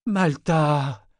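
high, drawled name; slurry, lingering call; drawling. A narrator's reading. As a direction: drag the name out drunkenly. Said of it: "d'une voix traînante".

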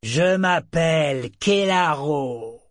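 triumphant slow bellow; loud proclamation. A narrator's reading. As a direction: proclaim it to everyone around. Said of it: "brailla-t-il".

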